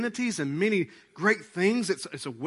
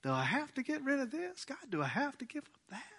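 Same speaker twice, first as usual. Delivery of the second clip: reluctant mumble; 10 semitones higher, 8 dB quieter than usual. Retold or imitated. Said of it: "Now it's more like".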